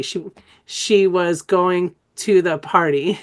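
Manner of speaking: very slow "she was going to the party", each word separate; drawn-out word by word. Shown as a demonstration. Teacher previewing an exercise for trainees, drawn-out word by word. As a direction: stretch every word, giving "was" and "to" full vowels. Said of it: "I'm gonna show you how to do that".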